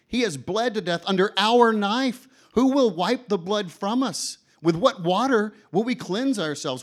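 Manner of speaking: high, loud, anguished proclamation; the madman accuses the laughing crowd; frantic madman. Acted. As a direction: declare it like a man possessed. Madman's speech continues.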